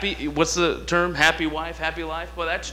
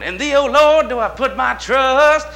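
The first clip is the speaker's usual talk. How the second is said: much higher, louder, slow call-back of "In thee, O Lord"; deacons echoing; 9 semitones higher, 7 dB louder than usual. Retold or imitated. Deacons echoing the scripture back, loud and high. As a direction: echo it back like a fired-up deacon. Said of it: "the other deacons started going".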